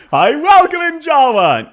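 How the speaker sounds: very high, loud greeting; called-out welcome. Real, not acted. Streamer welcoming a viewer by name, high loud call-out. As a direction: sing out a high, excited hello.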